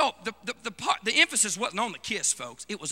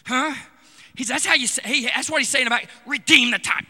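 ordinary talk, nothing marked

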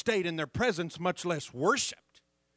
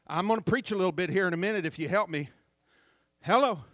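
usual speaking voice

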